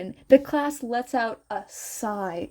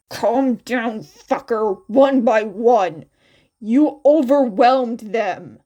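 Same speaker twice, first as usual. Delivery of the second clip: louder gruff order to the crowd; shouting; 7 dB louder than usual. Acted. Kacchan shouts at classmates swarming his friends. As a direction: bark it over the noise, louder. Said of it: "Kacchan shouts".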